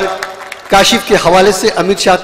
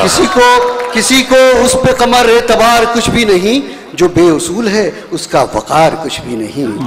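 declaimed verse, each line building; stately nazm recitation; 4 semitones higher, slower than usual. Acted. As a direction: recite the poem with stately force. Closing couplet of the nazm.

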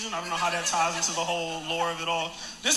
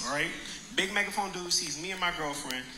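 normal talking voice

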